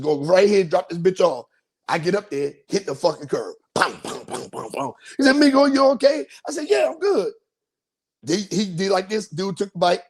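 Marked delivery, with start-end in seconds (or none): 3.7-5.0 rapid string of imitated impacts; crash noises. Imitates hitting the curb. 5.4-6.3 high, loud calling imitation; concerned bystander. Rental man checks on rider. 6.7-7.3 high-pitched retold reply; comic reassurance. Reassuring man after crash.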